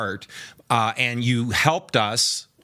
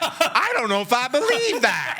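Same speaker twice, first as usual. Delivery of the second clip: high, laughing mock Southern drawl; hammed-up Southern drawl, half laughing; imitating a Southern drawl playfully; Southern drawl impression; 12 semitones higher than usual. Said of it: "That's his attempt at Southern drawl".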